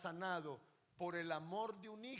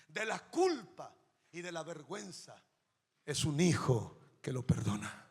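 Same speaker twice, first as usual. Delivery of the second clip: loud, preached declaration; booming sermon proclamation; God proclaiming; 9 dB louder than usual. Retold or imitated. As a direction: thunder it out as God's word. God's quoted proclamation, preached.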